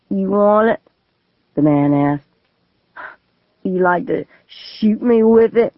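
put-on menacing drunk man. Gunman taunts the boy.

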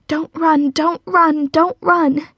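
high, repeated plea to herself; tense inner chant; anxious self-urging. A narrator's reading. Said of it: "she said over and over in her mind".

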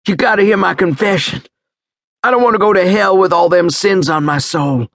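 high, urgent begging; desperate plea. Acted. Dying soldier begs medic to hear his confession, desperate. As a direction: beg as if your soul depends on it.

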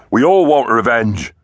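high, loud gruff threat; menacing mammoth. A narrator's reading. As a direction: push it out menacingly. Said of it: "swinging his trunk menacingly".

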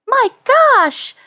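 put-on high-pitched boyish exclamation of shock; astonished high yelp; startled cry. A narrator's reading. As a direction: cry out, voice leaping way up. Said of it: "Neil cried out".

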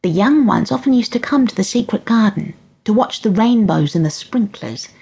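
lightly voiced grandmother, hushed at the end; wistful granny fading to a whisper. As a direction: confide the loss, trailing away. Granny confiding about fairies.

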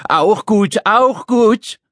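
high mocking squawk of a little man; screeching echo. Little man echoes Dad's answer, screeching. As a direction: squawk it back in outrage. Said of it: "kreischte der Kleine".

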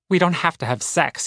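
ordinary delivery

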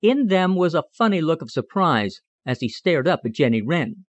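plain reading voice

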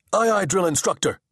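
high, crisp answering voice; eager assistant. A narrator's reading. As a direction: snap back at the superior.

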